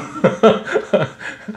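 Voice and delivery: breathy, voiceless chuckle; short laugh to yourself after teasing listeners. Real, not acted. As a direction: laugh it off briefly. Teasing congregation about Wednesday service.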